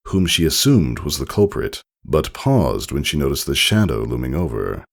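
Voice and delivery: usual speaking voice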